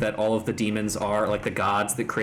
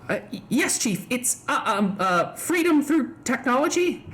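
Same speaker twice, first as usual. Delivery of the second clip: higher, hesitant underling; timid Wedge voice; 12 semitones higher than usual. Acted. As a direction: stammer like a nervous lackey.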